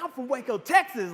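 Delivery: lightly hammed-up hometown pride; mock excited boast. Speaker imitating himself worked up about home, mock excitement. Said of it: "gotten really excited or energetic or".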